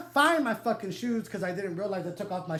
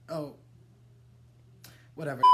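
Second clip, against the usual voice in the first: ordinary talk, nothing marked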